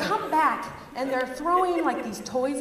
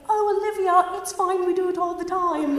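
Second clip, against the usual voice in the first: put-on higher sweet, coaxing kids' voice; posh British kids; 8 semitones higher, 6 dB louder than usual. British boys coaxing their babysitter Olivia, high and sweet. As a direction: coax her like polite British boys. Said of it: "They're just like".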